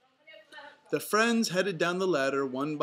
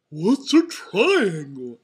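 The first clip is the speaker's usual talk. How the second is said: very loud, drawn-out goofball; Goofy asking; 3 semitones higher, 13 dB louder, slower than usual. A narrator's reading. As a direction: ask in a slow, booming cartoon voice. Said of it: "asked Goofy".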